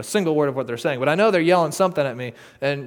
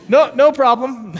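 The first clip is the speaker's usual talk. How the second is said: ironic amusement; 7 semitones higher, 9 dB louder than usual. Real, not acted. Ironic compliance with laughter.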